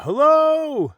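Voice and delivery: high, loud call into the woods; yelling hello. Retold or imitated. Hiker imitating a passerby calling out to his partner, loud high yell. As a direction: belt it out to someone far off. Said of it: "he starts yelling out".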